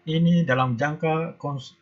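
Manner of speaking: normal talking voice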